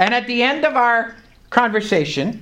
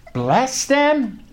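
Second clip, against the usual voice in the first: higher, questioning echo of the command; incredulous echo; 6 semitones higher than usual. Retold or imitated. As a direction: repeat it back as a doubtful question. His startled echo of God's command.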